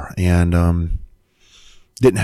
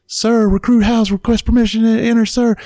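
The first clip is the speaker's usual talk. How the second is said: recruit's repeated request. Retold or imitated. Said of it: "I keep requesting permission".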